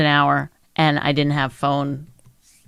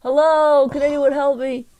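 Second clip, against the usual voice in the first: high, raised call through the elevator door; calling for help; 9 semitones higher than usual. Retold or imitated. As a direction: call out for help from between floors. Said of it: "So I had to be like".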